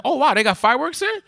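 high, fast, wide-eyed wonder; retold amazed surprise. Teen at a block party hearing shots, amazed. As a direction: sound delighted by the fireworks. Retelling naive surprise at gunshots.

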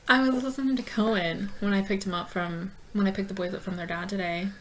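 ordinary talk

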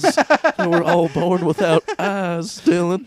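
slow, drawn-out mock lament, building loud and high; tragic coworker act. Coworker piling misery on Dylan, hammed-up lament. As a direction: wail the absurd tragedy slowly, hammed-up.

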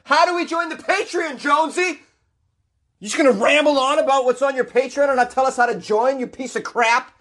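high, put-on nagging heckler. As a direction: play a cranky listener heckling the host. Heckling own voice to himself.

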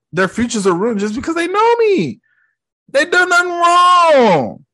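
imitating a kid begging on friends' behalf; mock desperate plea. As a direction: plead in a hammed-up high wail. Peter pleading for his loved ones.

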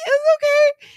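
tiny squeak of mock sobbing; extremely high octave. Sobbing owner answering the receptionist, absurdly high. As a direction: squeal it as if choking back tears. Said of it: "only dogs could hear me at this point".

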